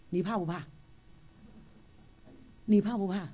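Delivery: usual speaking voice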